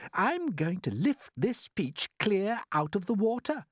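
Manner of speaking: slow, steady, determined; firm announcement. A narrator's reading. James announcing his plan, firmly. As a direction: state it with resolve. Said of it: "James announced firmly".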